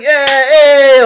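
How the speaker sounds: high, loud whoop of greeting; rowdy cheering. Retold or imitated. High colleague high-fiving random people, rowdy cheering. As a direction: cheer like a hyped-up concertgoer.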